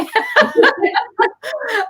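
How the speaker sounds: a high, teary laugh breaking through; emotional laugh. Real, not acted. Host moved by guest's story, high teary laugh. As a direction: laugh to cover getting choked up.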